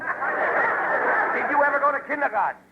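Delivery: high, slow, drawn-out question; incredulous high-pitched climax to a grilling; exasperated demand. Acted. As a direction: stretch it out in utter exasperation. Last, most exasperated question of series.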